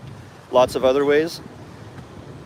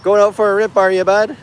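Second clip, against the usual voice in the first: put-on playful Canadian accent, pitched higher; 6 semitones higher, 3 dB louder than usual. To the boat driver on the lake, joking Canadian accent. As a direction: ham up a Canadian bud. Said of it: "a really Canadian, uh, accent".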